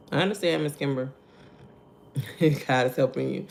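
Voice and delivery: ordinary delivery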